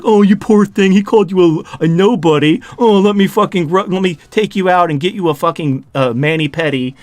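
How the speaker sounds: coddle them like a girlfriend, mockingly; mock womanly sympathy. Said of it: "they were fucking acting like women".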